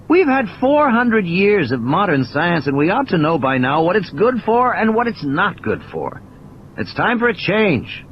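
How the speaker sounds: lightly voiced mathematician, lecturing; a tired, wry lecturer holding forth; a hint of a pained, preachy Malcolm; Malcolm, weary. Malcolm insists.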